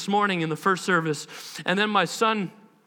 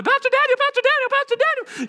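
put-on child calling; 5 dB louder than usual. Kid voice calling pastor.

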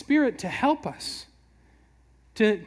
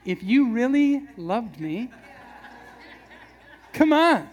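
slow, wheedling plea ending in a laughing, louder come on; guilt-trip line; 3 semitones higher, 4 dB louder than usual. Pretend. Husband pulling the love line on his wife, laughing whine. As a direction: pull the guilt card with a grin. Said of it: "you gotta pull out the line".

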